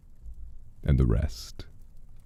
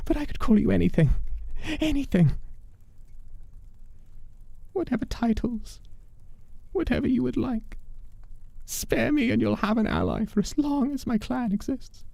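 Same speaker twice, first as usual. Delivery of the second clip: much higher, eager voice; eager bargaining man; 4 dB quieter than usual. Acted. Man offers the demon titles and an ally, high and eager.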